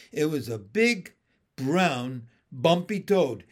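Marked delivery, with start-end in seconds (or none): none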